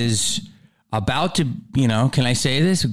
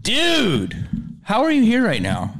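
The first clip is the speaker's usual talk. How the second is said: surprised; 7 semitones higher than usual. Real, not acted. Host amazed guest showed up.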